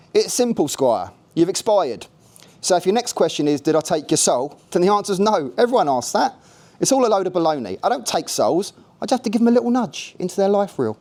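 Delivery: breezy Cockney bloke explaining; Cockney Jake. A narrator's reading. Jake explains death to the newcomer in light Cockney. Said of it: "Jake spoke in a Cockney voice".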